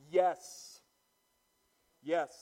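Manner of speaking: "Yes" punched loud and firm each time; emphatic yes. Retold or imitated. Martha affirming her faith to Jesus, emphatic yes. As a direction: hit each "Yes" hard. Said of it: "in emphatic".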